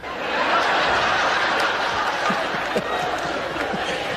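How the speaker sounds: long breathy laugh at his own joke; long wheezy laugh. Real, not acted.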